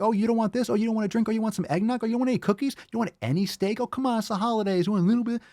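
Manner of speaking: high-pitched sing-song food pusher; pushy relative. Acted. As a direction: imitate a wheedling relative offering treats. Mimics relatives pushing food.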